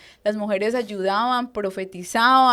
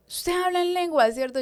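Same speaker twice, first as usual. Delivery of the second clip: higher-pitched retold questioning; imitated leader; 9 semitones higher than usual. Leader checking whether she speaks in tongues, imitated questioning. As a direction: put the question in a raised voice.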